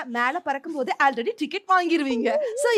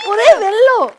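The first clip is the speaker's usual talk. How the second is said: much louder, punched cry; loud dramatic repeat; 5 semitones higher, 11 dB louder than usual. Acted. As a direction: belt it out dramatically. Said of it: "ஏன் திருப்பி திருப்பி சொல்றீங்க".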